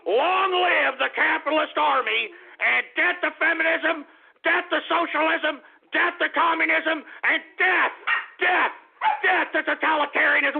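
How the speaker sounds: high, strained sloganeering holler; rallying cry. Acted. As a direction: proclaim it like a rabble-rouser to the troops.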